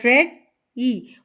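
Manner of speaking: "रेड, ई" as two separate parts; split into syllables. Shown as a demonstration. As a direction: break ready into "रेड" and "ई" with a pause. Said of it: "इसके टू parts हैं". ("रेड, ई" दो हिस्सों में रुक कर)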